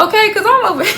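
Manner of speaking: high, breathless laugh; laughing through the words; giddy laughing. Real, not acted. Giddy over fine man.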